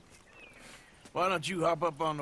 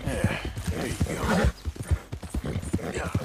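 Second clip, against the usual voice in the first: soothing a scared dog; 3 semitones lower, 4 dB louder than usual. Acted. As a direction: gently settle a frightened animal. Calming a horse in a game.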